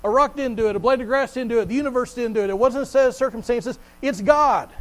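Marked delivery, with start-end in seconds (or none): 4.0-4.7 louder, higher punch on "God"; preacher's triumphant answer; emphatic declaration. Real, not acted. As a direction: land "It's God" hard and high. Preacher's climax after list.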